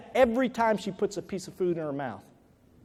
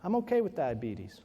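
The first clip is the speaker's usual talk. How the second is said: quiet; 5 semitones lower, 8 dB quieter than usual. Retold or imitated. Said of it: "what she was saying to me is".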